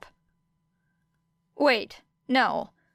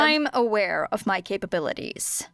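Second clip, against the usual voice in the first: ordinary delivery, nothing marked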